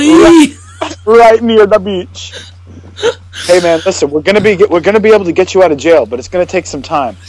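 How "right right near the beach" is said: slow echo of the quote; slow repeat. Retold or imitated. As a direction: drag the line out.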